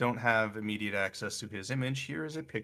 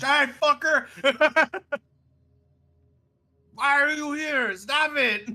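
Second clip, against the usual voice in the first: loud high exasperated yell; mock yelling; 8 dB louder than usual. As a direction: yell it out at them. Mock venting at someone.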